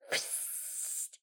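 sharp unvoiced hiss; cat hiss. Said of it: "and said".

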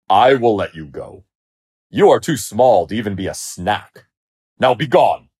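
haughty, emphatic dismissal; imperious lion. A narrator's reading. Lion grandly dismisses the mouse.

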